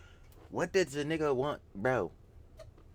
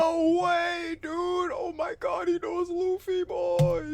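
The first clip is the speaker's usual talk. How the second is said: excited shout; slower than usual. Real, not acted. Streamer spotting an anime fan, gleeful high shout. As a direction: yell out in gleeful shock.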